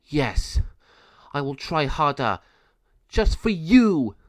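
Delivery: firm, then punch the slow last words; angry. A narrator's reading. Penelo defying Malvado to save Mariana, angry. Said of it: "became very angry and said".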